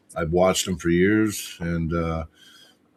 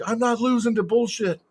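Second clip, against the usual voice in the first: retold screaming; 12 semitones higher than usual.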